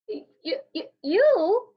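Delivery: high, stammering, astonished; stunned stammer. A narrator's reading. Alfred sees Rex looks like him, stammering in shock. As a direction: stutter in disbelief.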